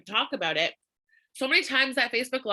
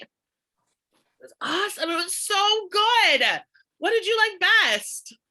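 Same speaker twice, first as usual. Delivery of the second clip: excited demo; 9 semitones higher than usual. Shown as a demonstration. Showing the class the excited sales voice, high and gushing. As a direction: gush with big energy. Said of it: "That's how we talk".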